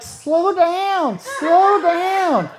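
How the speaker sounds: high, loud, desperate plea; pleading yell. Retold or imitated. Man pleading with the woman on top, high and loud. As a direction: beg her to ease up, loudly.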